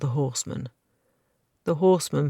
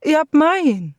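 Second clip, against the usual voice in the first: pleading; 13 semitones higher, 9 dB louder than usual. A narrator's reading. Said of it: "she pleaded".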